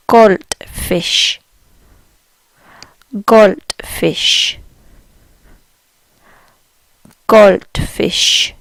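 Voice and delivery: Goldfisch with the D as a T and a sh ending; German Goldfisch. Shown as a demonstration. Teacher modelling Goldfisch for learners, careful German pronunciation. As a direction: say Goldfisch as golt-fisch. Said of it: "German for goldfish is pretty similar".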